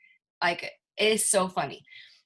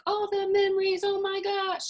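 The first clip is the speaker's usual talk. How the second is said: high-pitched swooning exclamation; mock overwhelmed gushing; 12 semitones higher than usual. Listener flooded by childhood memories, high mock gushing. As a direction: act swept away, voice pitched way up.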